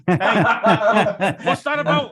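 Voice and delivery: laughs through a fake-offended hey; laughing mock protest. Real, not acted. Co-host teased about his accent, laughing mock protest. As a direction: pretend to be insulted while laughing.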